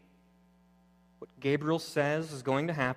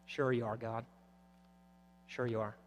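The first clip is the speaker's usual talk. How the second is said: low, very soft, unconvinced aside; quiet, deflated doubt; doubting mutter; 4 semitones lower, 10 dB quieter than usual. Acted. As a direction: drop to a small, skeptical murmur. Acted doubting aside after bold prayer.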